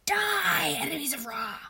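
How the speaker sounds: loud battle cry, easing off after the first word; yelling. A narrator's reading. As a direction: bellow a goddess's death threat. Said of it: "Sekhmet yelled".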